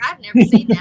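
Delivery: loud, startled outburst at strange food; mock-shocked child at the school lunch; shocked kid. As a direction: blurt it out in shock. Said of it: "and you're like".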